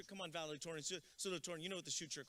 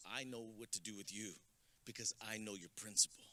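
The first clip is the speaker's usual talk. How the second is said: a hint of a low, softer threatening clerk; low menacing clerk; 11 semitones lower, 3 dB quieter than usual. Clerk threatening a caught shoplifter, lightly voiced low menace. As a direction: lightly play the clerk, dropping low and soft.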